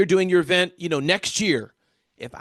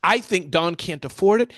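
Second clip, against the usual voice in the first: normal talking voice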